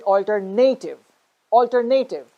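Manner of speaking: alternative as alter-NAY-tive; stress on third syllable. Shown as a demonstration. Teacher showing the common mistake, alternative as alter-NAY-tive. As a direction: punch NAY in alternative, full ay vowel. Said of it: "we are actually stressing the third syllable".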